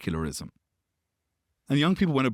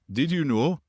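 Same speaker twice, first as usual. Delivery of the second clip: put-on Scottish accent; 5 semitones higher, 3 dB louder than usual. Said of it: "in my best Scottish accent".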